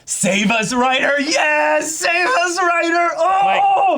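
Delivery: very high, hyped-up fan yell; excited shouting. Real, not acted. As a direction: cheer it out at the top of your range. Fan exploding at catchphrase.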